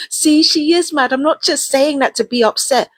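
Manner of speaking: high, loud sneering mimicry of the neighbors; mocking the neighbors. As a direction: parrot them in a shrill, loud mock. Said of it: "or saying".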